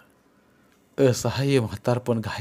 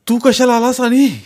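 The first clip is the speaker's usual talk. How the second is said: much higher and louder, startled fear; frightened; 12 semitones higher, 8 dB louder than usual. Acted. Scared father to his son arriving home, frightened cry. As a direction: blurt it out in panic. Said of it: "घाबरून ते म्हणाले".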